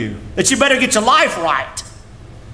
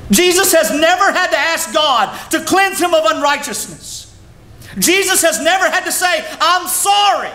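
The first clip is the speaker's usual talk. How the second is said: high, ringing proclamation; preaching shout; 8 semitones higher than usual. Real, not acted. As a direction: lift it up and call it out to the congregation. Preacher's climax on Christ.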